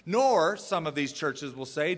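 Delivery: normal talking voice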